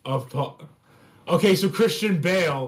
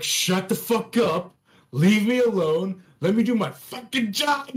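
raised-pitch fed-up outburst; acted exasperation; 6 semitones higher than usual. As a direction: rant at a micromanager, pitch climbing.

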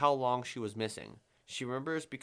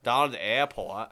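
northern Vermont drawl; 5 dB louder than usual. Retold or imitated. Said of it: "they talk like that".

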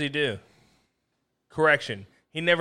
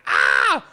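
mock scream; 6 dB louder than usual. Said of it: "He's like".

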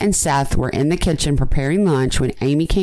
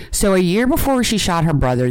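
usual speaking voice

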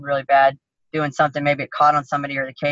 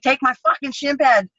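higher, angry demand; furious retold order to teammates; pissed-off yell; 8 semitones higher than usual. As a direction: snap it at the guys like you've had enough. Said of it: "I'm so pissed at this point".

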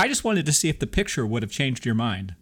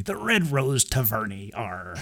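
pirate voice. Shown as a demonstration. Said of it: "Maybe that's what it's supposed to be".

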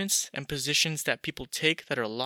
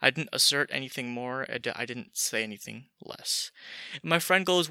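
usual speaking voice

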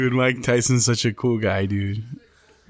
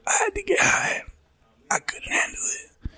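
whispered confession, choked up; imitating a broken whisper; tearful whisper; 4 dB quieter than usual. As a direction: whisper it, ashamed and crying. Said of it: "When he goes".

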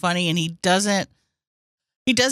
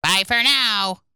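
a light take on Eric's sign-off; Eric impression; slower than usual. Host imitating Eric's sign-off for a co-host. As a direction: say it like Eric from Howard Stern. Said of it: "Oh, I love Eric".

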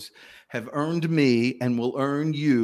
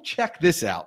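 normal talking voice

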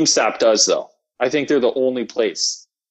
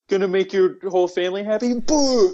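high, gloating jeer; mocking taunt; 6 semitones higher than usual. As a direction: rub it in their faces. Taunting shoppers in acted scene.

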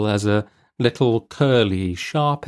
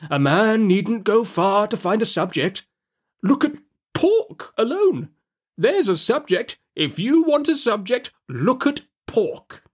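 much higher, slow pompous uncle holding forth; 11 semitones higher than usual. A narrator's reading. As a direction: savour every word like a self-important windbag. Pumblechook holds forth on pork.

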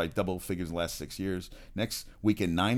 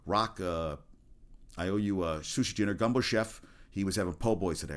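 normal talking voice

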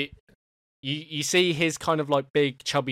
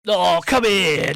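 eager welcome; 6 semitones higher, 9 dB louder, slower than usual. Acted. Baker going for a hug.

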